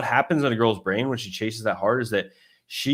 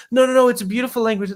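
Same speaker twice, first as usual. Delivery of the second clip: much higher, fast mock girl protest; 12 semitones higher, 4 dB louder, faster than usual. Retelling the girl defending French, high fast mock voice. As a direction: imitate her insisting, high and rushed.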